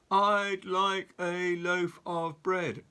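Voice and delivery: slow, drawn-out loud roar. Acted. Mister Noisy roars his order, slow and loud. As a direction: roar every word slowly. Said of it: "roared Mister Noisy".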